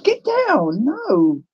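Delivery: sharp high command. Real, not acted. Telling a pet to get down.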